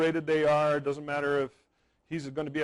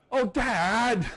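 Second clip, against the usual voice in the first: mock teen daughter; 10 semitones higher, 7 dB louder than usual. Daughter's retort in told anecdote.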